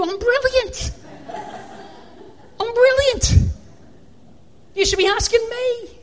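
tongue-in-cheek bragging to himself; mock boast. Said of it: "I'm thinking".

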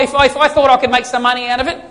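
normal talking voice

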